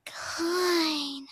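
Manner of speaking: put-on high cute anime girl voice. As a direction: chirp the word like an anime girl. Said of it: "I'm doing my anime voice".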